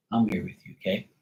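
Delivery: soft reassuring coo to a scared dog; soothing pet. Real, not acted.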